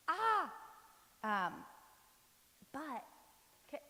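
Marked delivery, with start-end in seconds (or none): 0.1-0.5 high, loud "ah" of alarm; acted yelp at an unexpected guest; mock startled scream. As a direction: burst out with a hammed-up shriek. Imagining Jesus's surprise visit.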